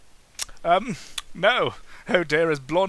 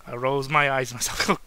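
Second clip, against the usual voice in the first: ordinary talk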